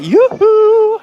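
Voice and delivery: high sing-song yoo-hoo, loud; playful call-out. Real, not acted. Greeting viewer Ellie, a loud high sing-song call. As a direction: call across the crowd to a friend.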